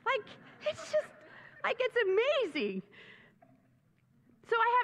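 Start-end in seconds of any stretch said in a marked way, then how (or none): 0.0-2.8 high, gushing delight; gushing excitement. Real, not acted. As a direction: let the wonder spill out, pitched up. Preacher gushes about meaning.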